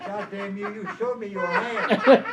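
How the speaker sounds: high, very loud, drawn-out cry through laughter; laughing mock outburst. Tricked card player accuses his opponent, hollered through laughter. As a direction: bellow the punchline, cracking up.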